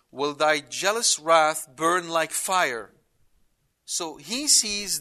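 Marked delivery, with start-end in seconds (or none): 0.1-2.9 slow, weighty scripture line; solemn reading. Acted. Preacher reads the verse to the congregation, slow and solemn. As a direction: draw out each word with gravity.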